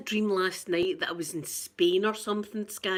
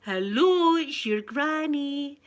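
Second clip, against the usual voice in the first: cooing to the bump; 6 semitones higher, 5 dB louder, slower than usual. Retold or imitated. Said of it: "I was going".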